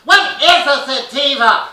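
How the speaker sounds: hammed-up soccer mom at the dispensary counter; clueless soccer mom. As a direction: squeal it like a baffled first-timer.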